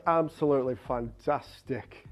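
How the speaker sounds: slow, low, bored-sounding talking voice. Retold or imitated. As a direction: mimic a dreary singer's speaking voice, drawn out. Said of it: "his talking voice".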